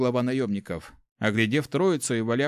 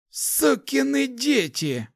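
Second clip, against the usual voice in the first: put-on high, strained old man's curse, slowly dragged out; hoarse croak; 13 semitones higher, much slower than usual. A narrator's reading. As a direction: wheeze the curse out in pain. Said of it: "прохрипел старик". (хрипло, натужно, протяжно)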